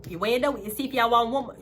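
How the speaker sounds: normal talking voice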